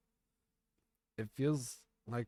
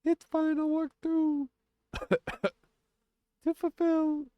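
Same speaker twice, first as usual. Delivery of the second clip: very high, loud ghost, coughing; choking ghostly messenger; 8 dB louder, much slower than usual. Acted. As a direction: push through the prophecy in falsetto between coughs.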